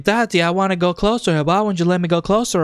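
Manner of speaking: retold pleading girl, high-pitched. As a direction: pitch it up for a child's plea. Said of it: "the pieces of the puzzle of the girl".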